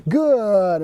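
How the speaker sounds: "good" held long on a falling glide; drawn-out marker word. Shown as a demonstration. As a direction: stretch "good" out slowly, easing down. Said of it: "So we're gonna go".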